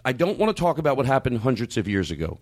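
normal talking voice

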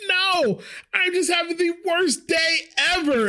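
very high, hammed-up wail; mock distressed wail. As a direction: wail it out in exaggerated despair. Acts out distressed answer to coworker.

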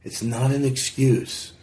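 slow, halting, choked-up confession; getting emotional. Real, not acted. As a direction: hold back real emotion as you speak. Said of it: "I'm getting a little bit emotional".

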